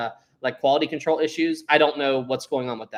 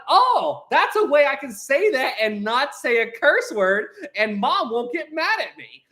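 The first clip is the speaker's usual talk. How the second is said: higher, excited little discovery; excited kid; 9 semitones higher, 3 dB louder than usual. Retold or imitated. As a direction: sound like a kid who found a loophole. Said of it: "So when I'm like".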